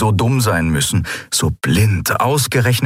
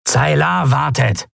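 high, slow call; 7 semitones higher, slower than usual. A narrator's reading. Kratergesicht summons Neran to Zaylar, calling out. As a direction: call out a reminder, drawn out. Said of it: "rief Kratergesicht".

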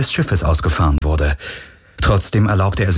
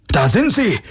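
high guard's call; guard shouting they're found; 11 semitones higher than usual. Acted. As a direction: call out spotting them. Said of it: "rief einer von ihnen".

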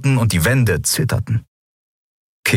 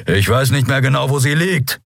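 irritated growl, pitch raised; 7 semitones higher than usual. Acted. Pilot snapping back at Endriel, irritated growl. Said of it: "knurrte er".

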